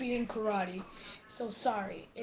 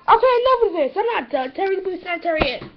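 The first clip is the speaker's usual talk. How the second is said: loud irritated outburst; fed up; 5 semitones higher, 9 dB louder, faster than usual. Acted. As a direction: snap louder, fed up.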